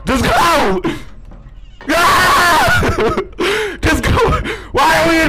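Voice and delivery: high terrified yelling; panicked screaming. Real, not acted. Chased by monster, panic.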